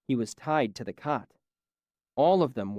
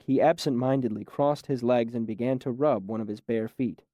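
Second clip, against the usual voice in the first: ordinary delivery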